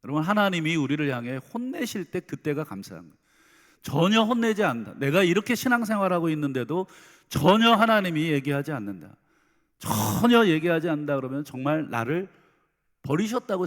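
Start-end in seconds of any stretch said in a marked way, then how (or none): 3.8-13.7 louder, higher, urgent pulpit cry; 목청 높여 호소하듯 설교하기; fervent preaching. Acted. Preacher rises to full force. (높고 큰 소리로 열정적인 설교)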